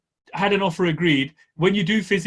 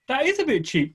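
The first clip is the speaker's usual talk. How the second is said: higher, bright pleased surprise; pleasantly surprised; 8 semitones higher than usual. Retold or imitated. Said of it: "but you think".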